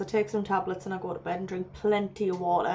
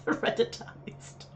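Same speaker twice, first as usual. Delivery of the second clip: laughing whisper; 10 semitones higher, 8 dB quieter, slower than usual. Real, not acted. Laughing at made-up word.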